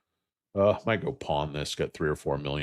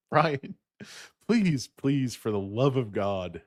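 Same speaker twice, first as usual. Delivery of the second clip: mock plea; 8 semitones higher, slower than usual. Mock pleading with a viewer.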